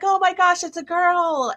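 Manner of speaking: high, bright cry of joy at the news; mock delighted squeal; excited gasp. Speaker's own reaction at reveal.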